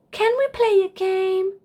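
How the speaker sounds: a little giraffe begging to play; bright kid asking a favour; young Charlie. Acted. Child asks grandparents.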